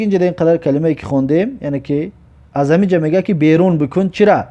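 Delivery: usual speaking voice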